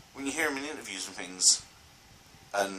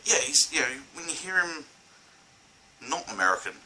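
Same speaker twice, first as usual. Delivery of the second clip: ordinary delivery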